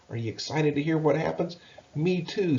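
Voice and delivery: ordinary delivery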